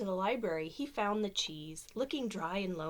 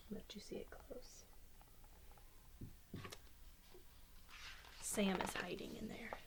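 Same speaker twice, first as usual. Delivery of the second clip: whisper, sharing a secret; 12 dB quieter than usual. Real, not acted. Showing picture to child.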